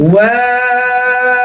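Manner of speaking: ওয়াও held long with round lips; drawn-out rounded letter. Shown as a demonstration. Teacher showing students how to hold ওয়াও, slow and round. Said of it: "এটাও গোল হবে".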